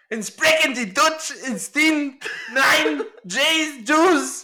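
hammed-up German accent in a Hitler rant; angry Hitler German. Mock German accent when his friend asks for a German. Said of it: "like, angry Hitler".

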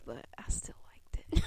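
whispered confession. Real, not acted. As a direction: whisper it sheepishly. Sheepish admission of liking.

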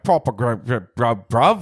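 imitated thick London accent; hard London accent. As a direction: stumble through a mock Londoner accent. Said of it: "hard Londoner's accent".

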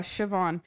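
Siobhan said as shuh-VAHN; pronounced with V. Shown as a demonstration. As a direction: clear V in the middle of Siobhan. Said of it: "Are you saying it with a V?".

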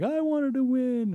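put-on high, slow wail of a small boy; kid's whine. Dad imitating his son after losing, high slow whine. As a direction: wail it like a kid who lost. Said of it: "he's like".

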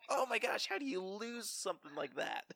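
high, startled gasp of disbelief; shocked reaction. Retold or imitated. Retelling the movie scare to a friend, high shocked squeal. Reacting to Paul Bunyan vanishing.

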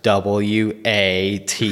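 "W A T" spelt out letter by letter, very slow; spelling it out slowly. Retold or imitated. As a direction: spell it out slowly to a puzzled flight attendant. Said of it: "And I was like".

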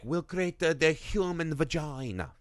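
put-on cartoon Italian accent. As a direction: speak as a mock Italian schemer. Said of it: "doing this Italian accent".

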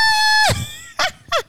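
high loud scream-laugh; shrieking laugh. Real, not acted. Laughing at her friend's tease, shrieking.

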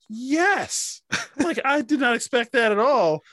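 high, loud thrilled yell of joy; excited cheer. Retold or imitated. Recalling the dragon reveal with a high excited cheer. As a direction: cheer like the dragon just appeared.

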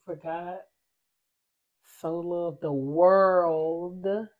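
slow, low, reverent reading; scripture recital. Acted. Reciting John 3:16 for listeners, slow and reverent. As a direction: intone the verse slowly like a preacher.

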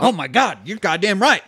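imitating a character's eager cry; excited agreement. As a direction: act out an excited sudden agreement. Acting out excited character's reply.

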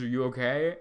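ordinary talk, nothing marked

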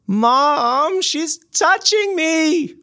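put-on high whiny kid tattling to mom; whiny tattling little sibling. Kid tattling in podcast intro.

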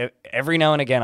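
ordinary talk

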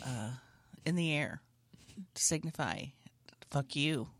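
low, halting official statement; police press conference. Acted. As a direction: read out a mock suspect description deadpan.

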